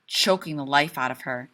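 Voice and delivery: ordinary delivery, nothing marked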